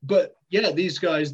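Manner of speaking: usual speaking voice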